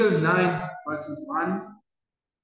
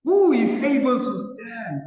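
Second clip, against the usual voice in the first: higher, louder desperate cry; 6 semitones higher, 5 dB louder than usual. Retold or imitated. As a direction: cry it out in anguish to heaven. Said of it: "they cry out and says".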